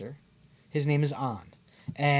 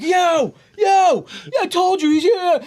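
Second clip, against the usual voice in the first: high, loud yo yo; excited calling; 13 semitones higher, 6 dB louder than usual. Retold or imitated. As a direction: call out to a friend on the phone. Friends calling on FaceTime.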